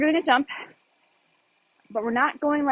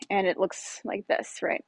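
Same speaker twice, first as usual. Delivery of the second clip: ordinary delivery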